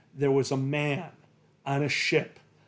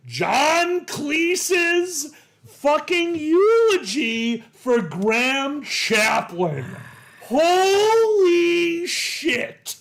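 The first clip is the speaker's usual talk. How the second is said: very high, loud and slow; overwhelmed shout; 11 semitones higher, 6 dB louder, much slower than usual. Real, not acted. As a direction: cry out in stunned awe. Overwhelmed recalling Cleese eulogy.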